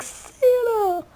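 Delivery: high, drawn-out excited call; excited cry. Retold or imitated. Imitating Danny all excited to see Santa, high excited cry. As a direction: imitate an excited kid calling out. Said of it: "Danny's all excited".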